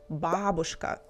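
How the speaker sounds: бабушка with stress on the first syllable; correct stress. Shown as a demonstration. Teacher naming the proper word, бабушка stressed on the first syllable. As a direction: lean on "BA" at the start of бабушка. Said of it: "stress in Russian we pronounce on the first part".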